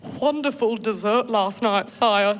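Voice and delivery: a lightly voiced prim British lady, slow; genteel English breakfast murmur, unhurried; posh British. Wife at breakfast to husband, lightly prim British. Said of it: "The girl looks at the guy".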